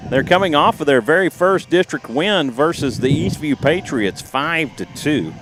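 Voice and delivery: steady radio baseball call; play-by-play. Acted. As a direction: call the game live.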